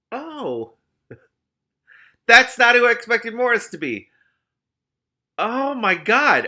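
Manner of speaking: high, loud gasp of surprise; genuinely shocked outburst; startled reaction. Real, not acted. Reader reacting to the story.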